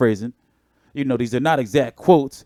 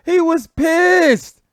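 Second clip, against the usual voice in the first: amused emphasis; 4 dB louder, much slower than usual. Real, not acted. Host reacting to an angry clip.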